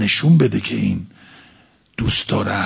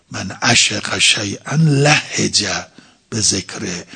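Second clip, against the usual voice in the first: slow recitation; slower than usual. Acted. Preacher quotes Arabic hadith again.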